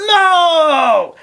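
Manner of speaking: a sudden high shout of protest; shocked shout. Retold or imitated. Student recoiling from the rabbi's demand, high shocked shout. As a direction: yelp it out as if struck.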